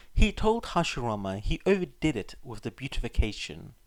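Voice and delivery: usual speaking voice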